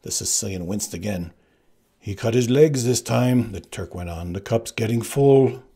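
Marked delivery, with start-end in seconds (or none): 2.0-3.5 louder, slower call; Turk calling out. Acted. Turk helping the bluff, calling out. 4.3-5.6 louder, slower call; Turk calling out. Acted. Turk helping the bluff, calling out.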